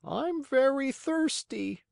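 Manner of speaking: high, slow bird voice; high-pitched weary pigeon; thirsty pigeon. Acted. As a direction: draw it out in a high voice. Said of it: "the pigeon said".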